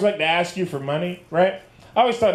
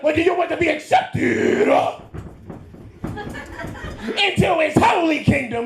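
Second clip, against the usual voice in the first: preacher voice; 11 semitones higher than usual. Retold or imitated. Preacher pressing again.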